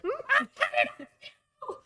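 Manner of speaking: high, loud goblin screech with a laugh; cackling goblin war cry; goblin yelling while charging. Acted.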